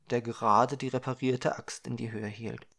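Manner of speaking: usual speaking voice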